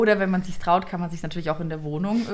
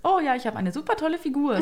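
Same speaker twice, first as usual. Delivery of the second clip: mock cheerful claim; 7 semitones higher than usual. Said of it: "wenn du sagst".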